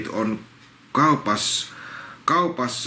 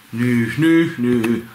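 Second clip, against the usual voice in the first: whiny troll snivel, pushed out; whimpering troll; much slower than usual. Acted. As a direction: whimper like a sulking tooth troll. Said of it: "Se nyhkyttää".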